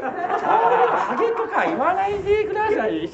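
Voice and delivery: high, drawn-out plea; high-pitched protest. Acted. Partner objecting to the bald jibe, high protest.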